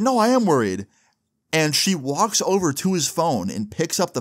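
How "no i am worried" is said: high-pitched retold protest; imitated mom yelling. Mom snaps back at her son, imitated yell. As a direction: push it high, like a yelling mother. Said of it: "the girl starts yelling".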